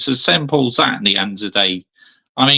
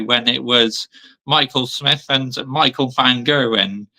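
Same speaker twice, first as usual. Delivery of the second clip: ordinary talk, nothing marked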